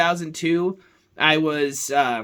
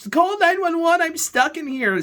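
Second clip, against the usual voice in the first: much higher-pitched imitation of panic; mock freaking out; 12 semitones higher, slower than usual. Sister playing lost begs haunted-house visitors for help, mock panic. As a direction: act out a pretend trapped victim's freak-out. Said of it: "she'd be, like, freaking out".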